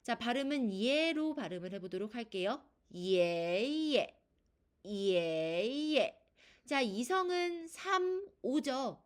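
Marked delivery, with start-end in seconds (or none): 2.9-4.2 예 drawn out rising, then a short dropped 예; long climbing 예, quick low 예; second tone plus neutral tone. Shown as a demonstration. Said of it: "미에서 솔로 올라가는 소리". 4.9-6.2 예 drawn out rising, then a short dropped 예; second tone plus neutral tone. Shown as a demonstration. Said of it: "미에서 솔로 올라가는 소리".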